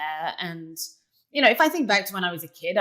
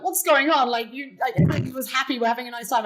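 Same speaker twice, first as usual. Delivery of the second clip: mock-panicked, raised pitch; anxious protest, higher voice; retold alarm; 6 semitones higher, 4 dB louder than usual. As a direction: replay your alarm, pitched high. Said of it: "now I'm terrified".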